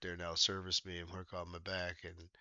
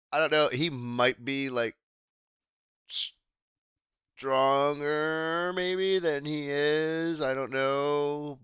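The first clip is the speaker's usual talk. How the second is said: drawn-out, higher, louder guessing; slow hesitant musing; 7 semitones higher, 7 dB louder, slower than usual. Real, not acted. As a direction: stretch each word while unsure. Hesitant guessing while laughing.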